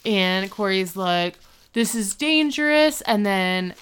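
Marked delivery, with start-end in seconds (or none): 1.7-3.0 high, louder mock alarm; retold alarmed warning. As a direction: warn a friend urgently, pitched up. Corey warns Sean in retelling.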